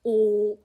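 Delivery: "Oo" through rounded lips; rounded vowel. Shown as a demonstration. Learner demonstrating the Korean vowel, "Oo" with rounded mouth. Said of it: "you have to round your mouth".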